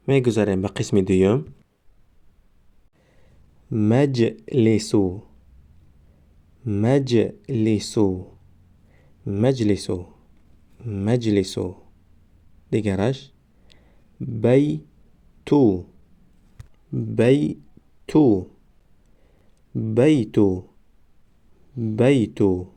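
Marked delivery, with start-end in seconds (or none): 3.7-8.3 مجلسو stretched very slowly, syllable by syllable; drawn-out demo. Shown as a demonstration. Teacher modelling the word for students, very slow. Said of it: "ما باید همان حرف را فقط آوازش را تلفظ کنیم". 14.2-18.5 بیتو stretched very slowly, syllable by syllable; drawn-out demo. Shown as a demonstration. Teacher modelling the word for students, very slow. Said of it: "ما باید همان حرف را فقط آوازش را تلفظ کنیم".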